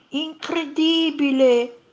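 high, slow, drawn-out wonder; amazed. A narrator's reading. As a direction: marvel loudly at the sight. Monster amazed by the sunset. (stupore meravigliato)

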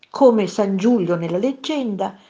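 plain reading voice

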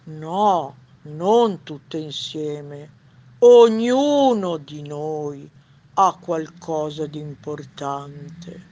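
slow, drawn-out wise owl. Acted. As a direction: ponder it slowly as the sage old owl. Wise owl voice in read story. (voce lenta da gufo saggio)

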